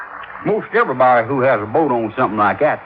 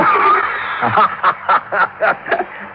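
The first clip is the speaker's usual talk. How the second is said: laugh; 3 semitones higher than usual. Acted. [name] laughs at own joke.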